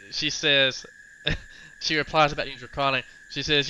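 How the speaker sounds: normal talking voice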